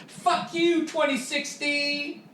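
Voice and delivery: high squeaky cursing at the year; tiny high-pitched curse at the year; mock squeal. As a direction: squeal it out like a cartoon.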